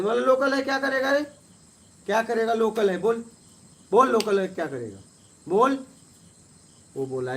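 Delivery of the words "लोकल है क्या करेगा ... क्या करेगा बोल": loud, high, taunting challenge; angry challenge. Retold or imitated. At the ghat, daring the locals who claim they are local: loud taunting challenge. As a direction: throw the word back at them, daring them.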